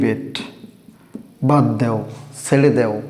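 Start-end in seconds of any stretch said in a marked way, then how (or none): none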